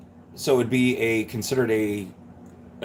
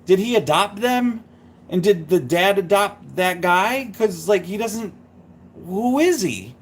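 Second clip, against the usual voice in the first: lightly voiced baffled child, higher pitch; a hint of a confused child, pitched up; confused kid; 6 semitones higher than usual. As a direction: puzzle it out as a bewildered kid. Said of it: "when I was a kid, I'm like".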